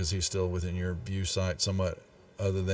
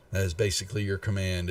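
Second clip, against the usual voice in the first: usual speaking voice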